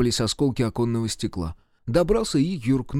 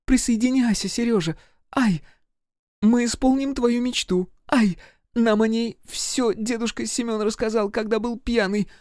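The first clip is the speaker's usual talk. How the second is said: put-on high, inviting girlish voice broken by yelps; modest girl calling; 11 semitones higher than usual. A narrator's reading. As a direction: call him over in a high little voice. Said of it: "звала скромная Лена". (высоким голоском звать к себе)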